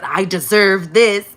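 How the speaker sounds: loud, slow, smug gloat. Retold or imitated. Neighbors gloating over your forgiveness, loud and smug. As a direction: savor every word like a gloater.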